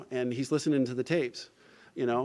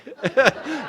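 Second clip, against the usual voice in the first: laughing; 11 semitones higher, 8 dB louder than usual. Real, not acted. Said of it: "So I started laughing".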